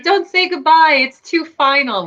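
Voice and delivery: hammed-up weepy goodbye; imitating crying mom. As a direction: wail it like an overemotional mother, teasingly. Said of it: "made fun of my mom for crying".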